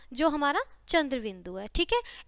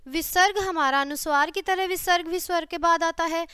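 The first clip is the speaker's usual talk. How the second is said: usual speaking voice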